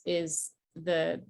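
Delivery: ordinary delivery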